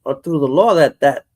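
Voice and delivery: normal talking voice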